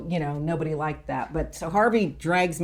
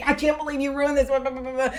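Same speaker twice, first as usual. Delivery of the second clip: high-pitched retold rant; mock ranting; 8 semitones higher, faster than usual. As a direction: rant indignantly, running into blah-blah. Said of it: "I'm ranting".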